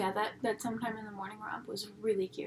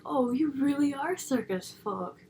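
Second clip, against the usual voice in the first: loud, higher, drawn-out mock realization; mock amazement; 4 semitones higher, 6 dB louder, slower than usual. Imitating everyone suddenly won over by clowns, mock amazed. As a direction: exaggerate the impressed discovery, stretched out. Mocking the crowd's sudden acceptance.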